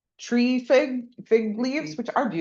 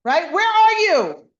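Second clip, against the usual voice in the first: higher, louder angry calling out; screaming call; 10 semitones higher, 9 dB louder than usual. Retold or imitated. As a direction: yell it across the house. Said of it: "maybe he's screaming".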